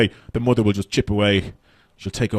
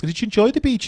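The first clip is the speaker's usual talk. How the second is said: high, singsong parent question; cheery parent; 11 semitones higher than usual. Retold or imitated. Parents asking the kids about the beach, high and cheery. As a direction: ask it like a bright parent. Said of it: "all the parents would ask".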